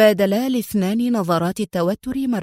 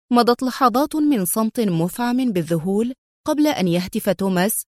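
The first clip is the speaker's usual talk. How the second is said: ordinary delivery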